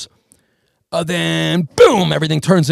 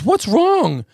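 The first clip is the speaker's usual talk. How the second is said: high worried mom voice; a mother asking in alarm, pitched up; 9 semitones higher than usual. Retold or imitated. To her son's friend, high and alarmed. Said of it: "the mom goes".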